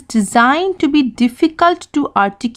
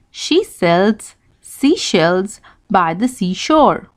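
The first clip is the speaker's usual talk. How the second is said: slow, clear reading; 3 semitones lower, slower than usual. Shown as a demonstration. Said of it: "can you quickly read the sentence after me".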